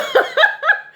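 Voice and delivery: loud high cackle; high burst of laughter. Real, not acted. Vlogger cracking up at own joke, loud high laugh.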